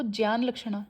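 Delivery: ज्ञान लक्षणा with ज्ञान as "jyaan"; j-y onset on ज्ञान; proper Sanskrit pronunciation. Shown as a demonstration. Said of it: "proper Sanskrit word अगर देखो".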